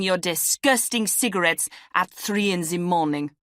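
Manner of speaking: put-on angry, loud French-accented tirade; heavy French accent. A narrator's reading. Asthmatic flatmate berating a smoker, heavy French accent. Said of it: "in a French accent heavier than Jean's".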